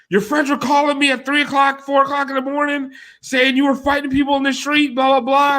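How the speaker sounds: high, mock scolding fiance; imitating fiance. Fiance scolding him over the phone: high mock scolding. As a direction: nag like an upset fiance.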